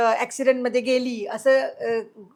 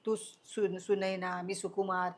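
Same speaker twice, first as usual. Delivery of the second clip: soothing friend; 4 semitones lower, 7 dB quieter than usual. Retold or imitated. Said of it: "तो म्हणाला".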